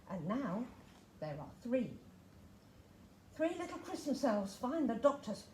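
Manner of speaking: ordinary delivery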